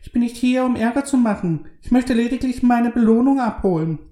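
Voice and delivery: put-on high, excited childlike voice; squeaky like an excited little kid; unpleasantly high voice. A narrator's reading. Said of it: "wie ein aufgeregtes kleines Kind".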